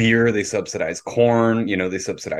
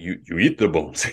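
lightly imitated Lithuanian accent; attempted Lithuanian accent; 3 semitones lower than usual. Lithuanian roommates answering about fish bones, in a badly faked accent. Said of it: "I don't know how to do their accent".

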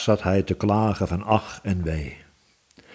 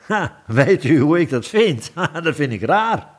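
high, swinging, scoffing protest; indignant protest; 7 semitones higher than usual. A narrator's reading. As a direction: object with an incredulous "ha". Thinker protests at the king's question.

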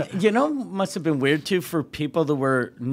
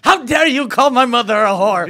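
loud, high, fast indignation; mock outrage; 9 semitones higher, 10 dB louder, faster than usual. Khabib answering a lunch invitation, absurdly outraged. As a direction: explode in hammed-up offence.

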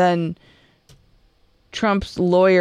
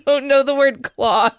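slow, higher words squeezed through laughter; laughing; 5 semitones higher than usual. Real, not acted. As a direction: struggle to finish while cracking up. Co-host cracking up, teasing.

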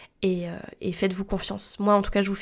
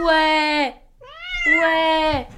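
cooing at a pet; 8 semitones higher, 4 dB louder than usual. Real, not acted. Talking to her cat.

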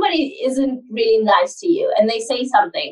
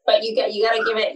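normal talking voice

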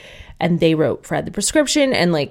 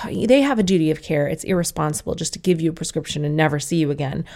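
ordinary delivery

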